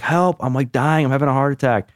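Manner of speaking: high panicked plea for help; mock panic. Teen to friend's mom, high mock panic.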